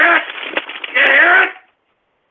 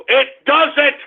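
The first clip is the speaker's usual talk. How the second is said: ordinary talk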